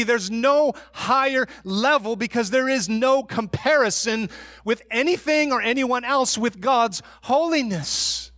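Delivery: ordinary talk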